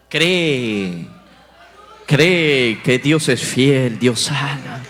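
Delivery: a pastor voicing God telling him to believe. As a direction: intone each word like a voice from heaven. God's voice in a testimony.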